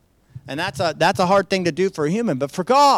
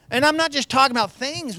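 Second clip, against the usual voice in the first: ordinary talk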